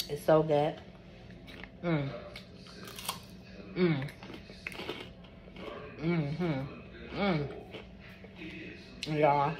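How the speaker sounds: talking while chewing popcorn; words around a mouthful; mouth full. Real, not acted. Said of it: "I'm talking with my mouth full".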